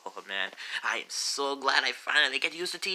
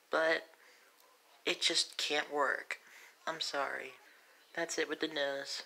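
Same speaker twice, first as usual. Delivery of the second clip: higher, gentle voice, sinking toward the sign-off; Princess Peach, newsreader; 4 semitones higher than usual. Acted. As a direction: read sad breaking news as a dainty princess. Plays Princess Peach reading news.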